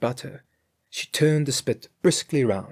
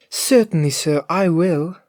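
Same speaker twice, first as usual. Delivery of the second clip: Grethel's voice; 6 semitones higher, 6 dB louder than usual. A narrator's reading. Grethel answers master.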